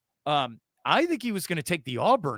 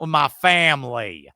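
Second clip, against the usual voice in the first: drawn-out, louder fake Southern twang; mock Southern accent; 2 semitones lower, 5 dB louder than usual. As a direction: imitate a put-on Southern accent, stretched out. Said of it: "you used the Southern accent".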